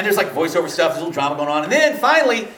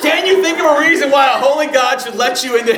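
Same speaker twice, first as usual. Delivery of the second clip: higher, louder, laughing through it; 8 semitones higher, 5 dB louder than usual. Retold or imitated. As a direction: read the gotcha question while cracking up. Survey's loaded question, laughing.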